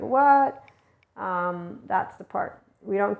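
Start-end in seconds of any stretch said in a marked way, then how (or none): 0.0-0.6 higher, louder startled "What?"; a sudden startled "What?"; playful mock surprise; surprised what. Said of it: "So it's more of like".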